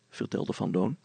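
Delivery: usual speaking voice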